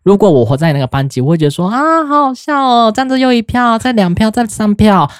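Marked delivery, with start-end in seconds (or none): 1.7-5.1 mocking glee. Imagining laughing along as a classmate.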